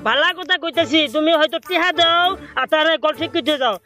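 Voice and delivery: ordinary talk, nothing marked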